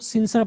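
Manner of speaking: सिंसियर squashed to "SIN-sar"; wrong pronunciation. Shown as a demonstration. Teacher quotes what people say, "sinsar". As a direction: say सिंसियर with a short "sər" ending. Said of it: "लोग सिंसियर पढ़ते हैं".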